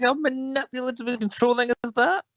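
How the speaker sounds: high, incredulous retort; appalled protest, acted out; retold outrage. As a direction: replay your indignation with pitch shooting up. Retells his outraged reply to date.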